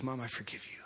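soft, understated quote of forgiveness; tender forgiveness. Retold or imitated. Voicing girl forgiving her mother.

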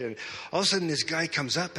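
ordinary talk

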